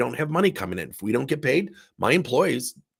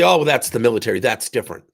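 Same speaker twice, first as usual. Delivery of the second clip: mock dismissive excuse; 8 dB louder, faster than usual. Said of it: "theatrical things that somebody could say".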